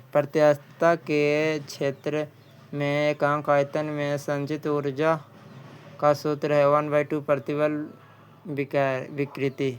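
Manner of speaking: normal talking voice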